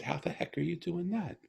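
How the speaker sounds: high-pitched incredulous question; retold amazement. Father amazed that his daughter talks without words, high-voiced astonishment.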